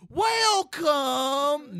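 high, loud hammy announcer welcome; mock announcer shout. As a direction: belt it like a game show host. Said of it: "you're gonna do, like".

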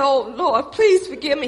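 loud begging plea to the Lord; loud pleading prayer; pleading cry. Retold or imitated. Pleading prayer in retold scene.

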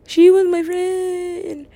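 high, drawn-out mock wail; mock grieving friends. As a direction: wail slowly like an overdramatic mourner. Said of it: "they were like".

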